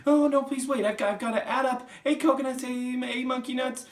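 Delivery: very high, loud, stammering schoolboy; flustered panic. Acted. Flustered Wilfred adding up for the Trunchbull, panicking. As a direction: squeal and trip over the sum. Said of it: "Poor Wilfred was properly flustered".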